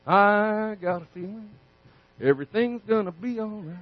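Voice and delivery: breezy fake optimism; mock positive thinker. Preacher mocks a positive thinker facing a giant, soft and breezy.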